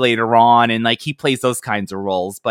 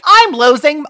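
high, loud hammy overacting; bad acting; 13 semitones higher, 7 dB louder than usual. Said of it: "the worst acting ever".

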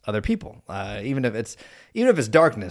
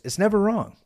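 ordinary delivery

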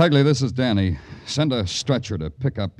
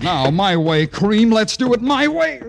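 loud, higher, forced out with effort; straining effort; 12 semitones higher, 8 dB louder than usual. Acted. Detective overpowers the yogi, straining loud. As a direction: grunt it out as you win the hold.

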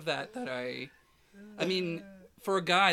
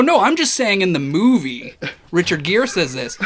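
louder, hurried retold protest with a laugh at the end; 4 semitones higher, 9 dB louder than usual. Retelling how he protested loudly to the photographer. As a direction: replay your own nervous, too-loud explanation.

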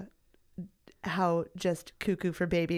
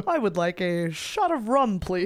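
straight man voice; 5 dB louder than usual. Retold or imitated. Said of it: "totally in the same voice".

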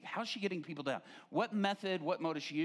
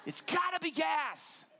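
mock alarm; 11 semitones higher, slower than usual. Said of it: "She's like, Shh".

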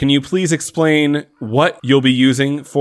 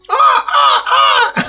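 group of players cawing like ravens; 4 dB louder than usual. Pretend. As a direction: caw like a flock of ravens. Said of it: "do the ensemble caw again".

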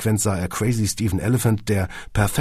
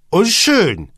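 insisting; 13 semitones higher, 9 dB louder than usual. A narrator's reading. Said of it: "gab Kunze zu bedenken".

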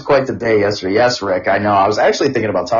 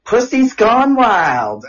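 slow, drawn-out, higher mock voice; young man's quip; 10 semitones higher, much slower than usual. Young man cracking a joke about the cat, slow drawn quip. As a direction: stretch it out slowly like a cheeky kid. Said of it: "Aiden says".

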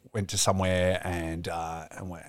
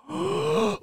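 high sharp mock gasp; imitated gasp. Imitating the fan seeing Robbie, a high startled gasp. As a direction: gasp in shock, imitating her. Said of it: "she just goes".

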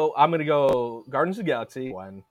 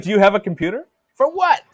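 mock character quote; 7 semitones higher, 8 dB louder than usual. Mocking a character's dialogue.

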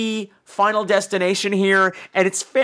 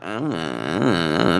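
long, low dopey "uh"; drawn-out know-nothing mumble, dropped way down; slow low moronic drone; mock dim groan; much slower than usual. Imitating Tommy's dumb noncommittal reply.

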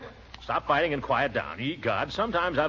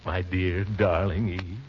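dreamy romantic; 7 semitones lower, slower than usual. Acted. Composing a romantic letter aloud.

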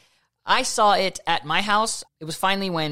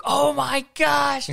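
a slow, drawn-out, higher oh my gosh in disbelief; stunned gasp; 10 semitones higher, 4 dB louder, much slower than usual. Retold or imitated. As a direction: let it stretch out in shocked disbelief. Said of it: "I was like".

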